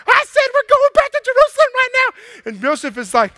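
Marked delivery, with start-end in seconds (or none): 0.1-2.1 high, loud, commanding; hammed-up shrill insistence. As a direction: bark it back at him high. Mary insists to Joseph.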